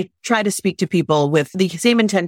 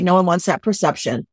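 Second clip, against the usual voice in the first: ordinary talk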